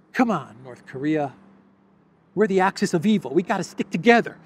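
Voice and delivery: a hint of a pleading Putin, laughing; lightly voiced coaxing dictator; mock Putin. Pundit voicing Putin coaxing Kim, lightly mocking. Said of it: "That's what Putin is saying".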